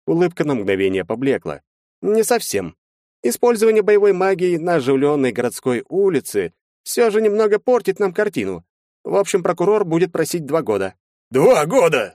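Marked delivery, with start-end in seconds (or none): 2.0-11.0 high-pitched, animated lawyer; lawyer character. A narrator's reading. Lawyer laying out the defense to his clients, high animated lawyer voice. 11.3-12.1 loud, high outcry; shouted. A narrator's reading. Vyazemsky reacting to a two-year sentence, shouted. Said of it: "закричал Вяземский".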